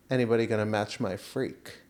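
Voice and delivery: ordinary talk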